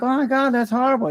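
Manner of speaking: a high, sympathetic mock gasp of a wife; imitating a shocked wife. Wife hearing of husband's DUI, high mock dismay. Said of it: "And she's like".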